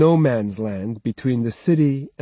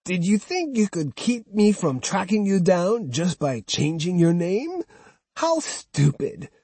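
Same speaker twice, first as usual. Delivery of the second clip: put-on high, contemptuous old woman's challenge; scornful old woman; 4 semitones higher than usual. Old woman daring the swordsman to fight, high and scornful. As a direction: spit the challenge like a vengeful grandmother.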